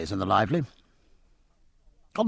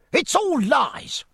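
a much higher, slow, furious cry; passionate cry; 3 dB louder, slower than usual. Acted. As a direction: hurl the denial in a rage. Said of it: "cried George Rogers ... in a horrid passion".

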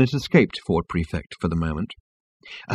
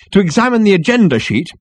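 high, loud strident voice; strident girl; 11 semitones higher, 5 dB louder than usual. A narrator's reading. Girl addresses Ford over agenda, stridently. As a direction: cut in stridently. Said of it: "the girl with the strident voice".

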